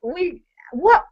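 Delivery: high shocked squeal; wife shocked by the sale; stunned what. Retold or imitated. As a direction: gasp it in disbelief.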